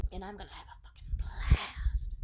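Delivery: a whispered aside; an unvoiced whisper aside. Real, not acted. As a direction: whisper it conspiratorially.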